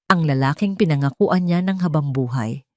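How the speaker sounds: ordinary delivery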